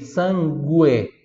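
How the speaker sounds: sangue slowly split as "san-GWEH"; Italian pronunciation. Shown as a demonstration. Teacher breaks down the word, sangue slowly in Italian. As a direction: draw out both syllables of sangue, U sounded. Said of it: "em italiano".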